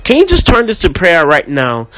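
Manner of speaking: ordinary delivery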